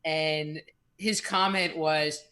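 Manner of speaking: usual speaking voice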